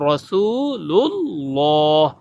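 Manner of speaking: রাসূলুল্লাহ very slow, with a thick lam; slow careful রাসূলুল্লাহ. Shown as a demonstration. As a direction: recite রাসূলুল্লাহ slowly, no r sound. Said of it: "লাম দিয়া হবে".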